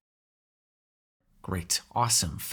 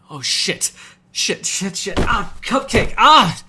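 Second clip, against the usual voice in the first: loud, high cursing that climbs to a yell; pained, panicky outburst; startled yelping; 6 semitones higher, 11 dB louder, much slower than usual. Acted. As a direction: yelp in protest as you're yanked away. Grabbed away from meal mid-bite.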